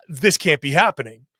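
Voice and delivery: ordinary talk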